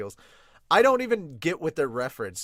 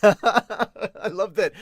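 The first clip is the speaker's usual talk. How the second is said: normal talking voice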